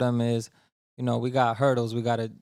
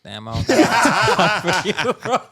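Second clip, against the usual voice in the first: loud, higher, drawn-out laughing protest; laughing disbelief; 7 semitones higher, 8 dB louder, slower than usual. Retold or imitated. Friend retelling his reaction to the long video, laughing disbelief. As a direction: laugh through the mock complaint.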